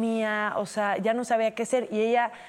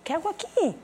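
higher, bewildered question; sudden realization; 7 semitones higher than usual. Retold or imitated. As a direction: wake up and suddenly doubt everything. Her own sudden realization retold. (asombro repentino, en tono más agudo)

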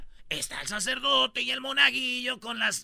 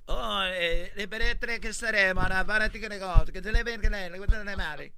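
mock Latin. Mocking the priest's ritual Latin, a hint of chanting. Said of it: "Soy así como que habla latín".

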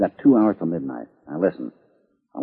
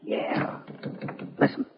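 whispering; 7 dB quieter than usual. Acted. Hiding, hearing men approach.

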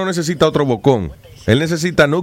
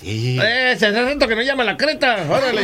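higher, laughing echo of the caller; laughing mimicry; 9 semitones higher than usual.

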